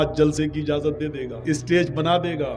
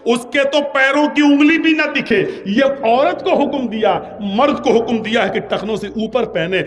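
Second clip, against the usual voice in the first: emphatic preaching; 8 semitones higher, 6 dB louder than usual. Acted. Preacher to the congregation, pressing the ruling loudly. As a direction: hammer home the rule for men and women.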